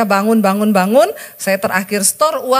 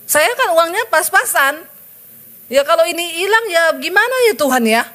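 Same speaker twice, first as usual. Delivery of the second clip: high, louder whining excuse; high, louder whining excuse to God; whiny plea to God; 8 semitones higher, 3 dB louder than usual. Retold or imitated. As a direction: complain to God like a worried child. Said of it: "Saya jawab".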